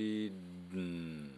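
"didn't" slowly, the D running into N without release; slowed-down demo of "didn't"; slow native didn't. Shown as a demonstration. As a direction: draw out "didn't" with the tongue held up. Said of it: "ゆっくりやるとこんな感じです".

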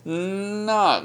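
a reluctant, lingering Not; hesitant drawn Not. Shown as a demonstration. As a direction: pause inside the stretched Not. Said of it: "ちょっとためがあったり".

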